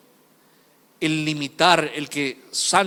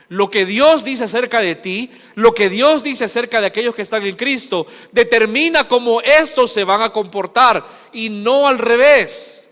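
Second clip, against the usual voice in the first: higher pulpit voice, slowing hard at the end; emphatic preaching; 6 semitones higher, 6 dB louder than usual. Real, not acted. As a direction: drive the point home, stretch the last words.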